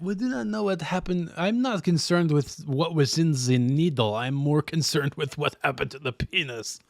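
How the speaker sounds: hammed-up French accent. Officer voices his concern in French accent. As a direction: state your concern in a mock French accent. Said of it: "we do the French accent".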